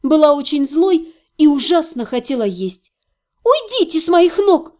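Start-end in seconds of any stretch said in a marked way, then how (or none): 3.4-4.7 high, indignant command; ordering. A narrator's reading. Patient orders healer off her legs, indignant high command. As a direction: order him off with raised pitch. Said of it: "приказала я целителю".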